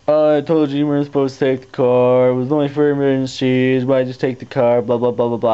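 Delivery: imitating angry mom. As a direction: mimic mom nagging. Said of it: "Mom was really pissed".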